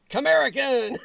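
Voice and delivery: sudden high, loud shout-out; loud, excited call. Real, not acted. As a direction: holler the name out. Calls out viewer's name.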